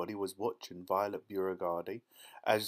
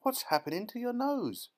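very high, alarmed question; high-pitched panicked mother; mother's shriek; 13 semitones higher than usual. A narrator's reading. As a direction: shriek it in horror at the child. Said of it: "shrieked Missus Beauregard".

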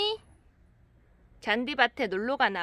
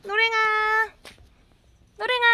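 calling out; 8 semitones higher than usual. Real, not acted. Calling cat, heard shout.

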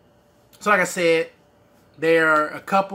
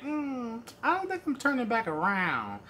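mock refusal; 7 semitones higher, 6 dB quieter than usual. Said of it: "And I'm like".

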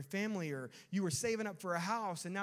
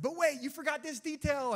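high, loud butting-in; eager interruption; 9 semitones higher, 6 dB louder than usual. Retold or imitated. Spouse breaking into the other's great story, eager interruption. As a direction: cut in with an urgent, higher-pitched correction.

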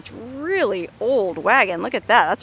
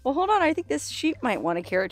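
ordinary delivery

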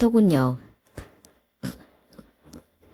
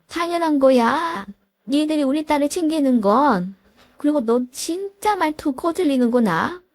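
a lightly voiced stern mother-in-law, a touch higher; 시어머니 목소리; 7 semitones higher than usual. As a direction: scold the daughter-in-law with cold authority. (살짝 높인 깐깐한 시어머니 목소리)

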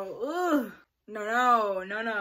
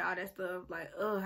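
ordinary talk

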